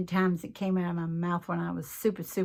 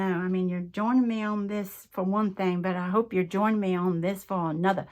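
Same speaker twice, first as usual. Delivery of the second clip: ordinary delivery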